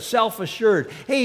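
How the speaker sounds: normal talking voice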